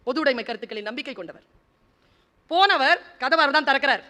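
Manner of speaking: ordinary talk, nothing marked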